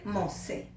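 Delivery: Mosé said very soft and low; quiet answer Mosé; plain m in Mosé, said softly; soft correct form. Shown as a demonstration. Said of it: "Cómo suena bien".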